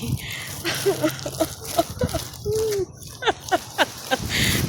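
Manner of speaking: high-pitched delighted laugh. Real, not acted. Vlogger laughing with delight at her garden, high.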